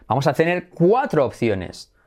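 usual speaking voice

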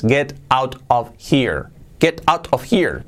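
slow "Get out of here", word by word; every t sounded in "Get out of here"; textbook English. Shown as a demonstration. Teacher translates "vete de aquí", textbook "Get out of here". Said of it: "Pero nadie habla así".

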